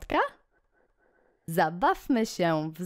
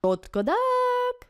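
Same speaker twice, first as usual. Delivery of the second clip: high slow cluck; hen clucking; 3 dB louder, slower than usual. Acted. Reading the poem to kids, a high slow hen cluck. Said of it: "Kura gdacze".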